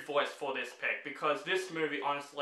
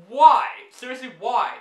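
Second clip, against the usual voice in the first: loud, high, fed-up cry of why; exasperated shout; 5 semitones higher, 11 dB louder than usual. Real, not acted. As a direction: burst out in disbelief at the film. Exasperated outburst at bad film.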